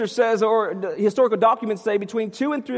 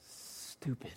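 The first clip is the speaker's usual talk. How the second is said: whispered child's bad word; hushed, breath-only imitation of a child; imitated whispered confession; 9 semitones lower, 21 dB quieter than usual. Small son confesses bad word.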